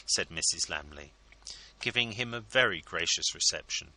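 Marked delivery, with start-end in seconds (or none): none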